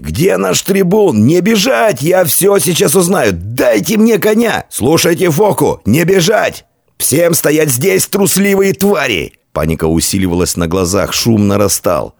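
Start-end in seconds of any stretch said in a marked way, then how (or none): 0.0-9.3 high-pitched, frantic commander's cries; yelling. A narrator's reading. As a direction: bellow orders at fleeing men. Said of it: "завопил сотник".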